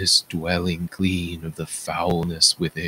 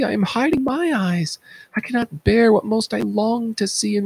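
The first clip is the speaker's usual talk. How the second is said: much higher, anguished chorus cry; horrified chorus; 14 semitones higher, 3 dB louder than usual. Acted. Chorus seeing the blinded king, crying out in horror. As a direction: recoil in horror at the sight.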